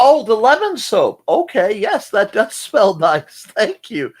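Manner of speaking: high, loud, stretched realization; light up as it clicks; excited. Real, not acted. Realizes the lemon soap smell.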